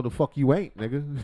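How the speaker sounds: ordinary talk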